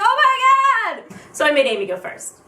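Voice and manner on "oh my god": high, drawn-out overwhelmed cry; mock freak-out. Fan told only one group picture, hammed-up freak-out. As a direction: stretch it out in high-pitched panic.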